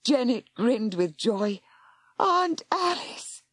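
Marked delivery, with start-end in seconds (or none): 2.2-3.4 high, slow gasp of joy; she's alive after all. Acted. Girl gasping at her living aunt, joyful. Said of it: "gasped Janet".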